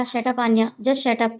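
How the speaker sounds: plain reading voice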